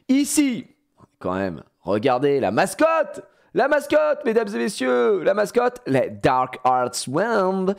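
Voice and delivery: high, loud game-show presenting; showman announcement. Acted. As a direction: sell the mascot to the audience. (présentation de foire, aiguë et forte)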